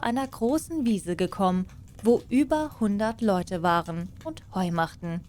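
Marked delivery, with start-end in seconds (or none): none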